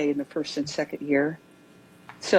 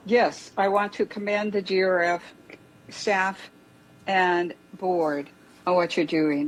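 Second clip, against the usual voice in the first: raised voice for the room; speaking up; 2 semitones higher than usual. Real, not acted. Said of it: "speak up, please?".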